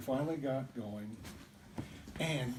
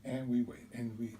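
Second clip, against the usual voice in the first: plain reading voice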